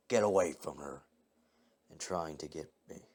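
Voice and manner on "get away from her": understated scream, louder on the attack; mild scream. A narrator's reading. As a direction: cry out in alarm, held back as narration. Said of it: "my mother wake up screaming".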